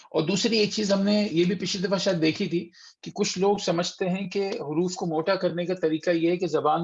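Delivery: ordinary talk, nothing marked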